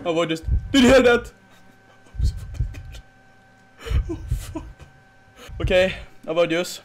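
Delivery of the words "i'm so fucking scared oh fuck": breathy hushed fear; frightened whisper. Real, not acted. Scared player hiding, whispering. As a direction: whisper so she won't hear.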